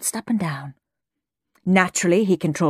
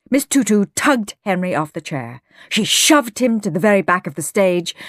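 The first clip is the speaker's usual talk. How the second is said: plain reading voice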